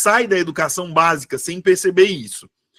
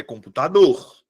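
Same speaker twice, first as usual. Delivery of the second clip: "computador" settled with the stress on DOR; lower-pitched "computador", landing on the final DOR; stress on last syllable; 5 semitones lower than usual. Shown as a demonstration. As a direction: confirm "computador" as computaDOR. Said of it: "A minha sílaba tônica é a última".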